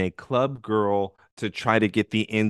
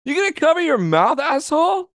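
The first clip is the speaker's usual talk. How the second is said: high, loud, quick rebuke; mock indignant scolding; 5 dB louder than usual. Viewer telling off the man who barely covered it, mock indignant. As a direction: scold him in a raised, pitched-up voice.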